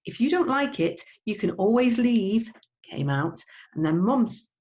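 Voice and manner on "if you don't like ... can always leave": heated retort. Acted. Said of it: "The phrase came out".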